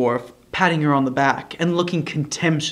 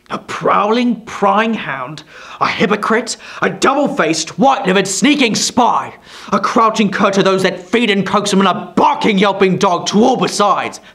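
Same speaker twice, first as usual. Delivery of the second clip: loud, raised spitting insults; venomous tirade; 5 semitones higher, 5 dB louder than usual. A narrator's reading. Quilp denouncing Kit to his allies, venomous. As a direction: spit each insult out.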